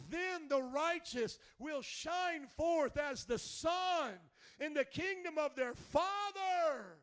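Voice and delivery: loud, high preacher's cry; rising, shouting sermon voice; full-force preaching. Acted. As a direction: preach it out to the whole room.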